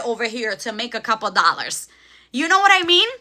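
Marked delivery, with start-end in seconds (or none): 2.3-3.1 worked up. Real, not acted. Heated rant continuing.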